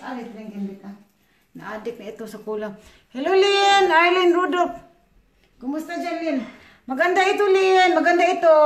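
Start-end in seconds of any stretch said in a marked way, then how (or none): 3.1-8.5 loud, high greeting to a viewer; calling out. Real, not acted. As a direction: shout a cheerful hello across the room. (masiglang pagtawag, malakas at mataas)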